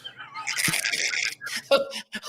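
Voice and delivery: airy, silent-ish wheezing laugh; breathy laugh. Real, not acted. Host wheezes with laughter at the fart joke.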